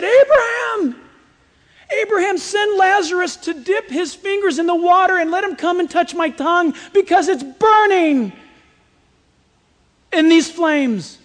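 high, loud cry for help across a gulf; desperate calling out. Acted. Rich man in hell begging Abraham for water, crying out loudly.

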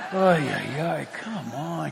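a hint of bored impatience, slow and soft; lightly voiced Elijah tapping his foot; impatient Elijah. Elijah mocking the slow prophets, impatient. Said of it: "He looks at his sundial".

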